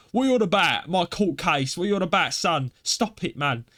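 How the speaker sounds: mock an angry Cockney lad protesting; imitated Cockney. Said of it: "he's got really Cockney".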